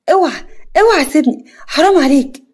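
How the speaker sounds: high, loud pleading cry to let go; frightened plea. Acted. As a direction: beg him to let go, panicked. Girl pleading while hair grabbed.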